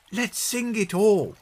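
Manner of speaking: put-on high child's voice, enthusiastic; high-pitched girlish plea; eager girl. A narrator's reading. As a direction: urge the family on excitedly. Peggy eagerly urges singing.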